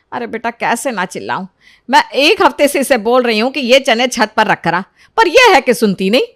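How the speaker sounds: loud, fed-up complaint; scolding mother. Acted. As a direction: complain sharply about a disobedient daughter. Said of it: "क्यों सुनीता पर चिल्ला रही हो". (तंग आई माँ की शिकायत)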